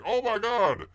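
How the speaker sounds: high-pitched mock alarmed cry; hammed-up kitchen panic. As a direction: imitate a panicking cook crying out. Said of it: "like that lady and saying".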